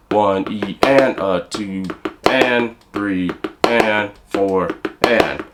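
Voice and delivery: slow count, weight on each "and" in "two and three and four and"; accent on the and. Shown as a demonstration. Teacher counts slowly to show accents on the and. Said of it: "move your accent to the third partial".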